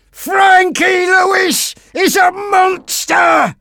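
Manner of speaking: slow, very high, furious scream; high-pitched, full-force enraged yell. A narrator's reading. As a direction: scream the accusation, drawing out each word. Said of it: "Sidney screamed".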